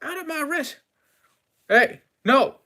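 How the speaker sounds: high, strained protest; shooing a critter. Acted. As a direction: shoo it off in a high voice. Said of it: "I grab the broom and say".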